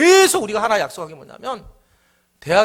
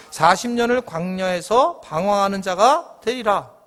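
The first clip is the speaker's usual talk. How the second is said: solemn scripture reading; 4 semitones lower than usual. Acted. Pastor reads God's oath to the congregation, slow and solemn. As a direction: proclaim each verse like a judgment.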